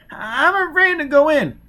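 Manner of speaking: put-on high, loud, desperate pleading child; high terrified child begging. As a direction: beg not to be sent in. Child afraid.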